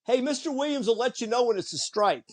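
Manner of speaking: high mock yell across the field; retold yell. Teammate yelling at the rookie pitcher, a high mock yell. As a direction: call it out high, half-hearted shout. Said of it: "he yells out".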